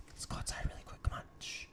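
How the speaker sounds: hushed retold whisper. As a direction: whisper as if coaxing friends outside quietly. Retold hushing to listen outside in dark.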